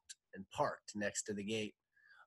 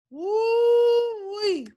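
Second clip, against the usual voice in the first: high, loud, long whoop; exhilarated high holler; 13 dB louder than usual. Real, not acted. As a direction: let out a drawn-out woo-wee. Reader whoops after finishing the story.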